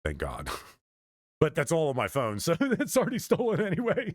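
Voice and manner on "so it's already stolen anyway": high-pitched laughing through the words. Real, not acted. Joking that it's all on the phone, real laughing. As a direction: let real laughter take over the line.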